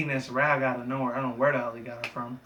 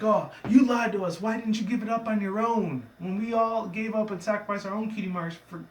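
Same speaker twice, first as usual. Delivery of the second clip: high-pitched, indignant pony voice; mock rage; 8 semitones higher than usual. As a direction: act out a betrayed accusation. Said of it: "all the ponies go into in a rage".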